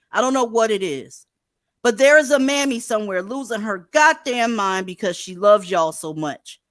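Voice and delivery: loud, high scolding rant; angry rant. Real, not acted. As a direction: chew him out loudly. Ranting at guest, raised voice.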